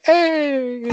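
high, drawn-out mock "hey"; hammed-up sitcom-style entrance call; imitated greeting. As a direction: imitate a big, long, high-pitched greeting. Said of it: "opens the bathroom door and it's like".

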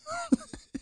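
soft high-pitched laugh; chuckle. Real, not acted. Man amused by his own fatherly advice, soft laughing. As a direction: let out a small high giggle.

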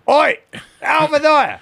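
very high, loud Scottish holler; exaggerated Scottish call; Scottish accent. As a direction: shout across in mock Scottish. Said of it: "the way that the Scottish talk".

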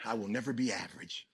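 imitating an unconvinced crowd, low and soft; mock half-hearted. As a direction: say it with no conviction, very quietly. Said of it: "some of you saying it average".

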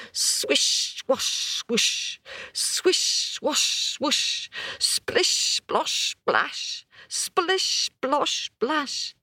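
whooshing then splashing sounds; water sound effects. Acted. Storyteller imitates the elephant splashing, playful. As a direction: play the elephant's spray with your voice.

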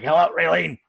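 a hint of a friend picking up the phone; Johnny impression. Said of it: "Yeah, there, perfect. You do Johnny".

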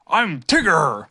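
bouncy odd creature voice; Tigger voice. A narrator's reading. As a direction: strange animal at the door.

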